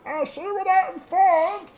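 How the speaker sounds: high, thin far-off reply; faint distant voice. Acted. Unseen helper answering guard from upstairs, faint voice. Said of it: "You hear a faint voice say".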